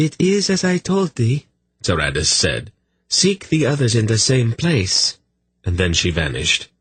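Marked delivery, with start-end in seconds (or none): 0.1-1.5 high, remote voice from beyond; otherworldly goddess. Acted. Goddess Tsaradis addressing the captain, high and otherworldly. 3.1-5.2 high, remote voice from beyond; otherworldly goddess. Acted. Goddess Tsaradis addressing the captain, high and otherworldly.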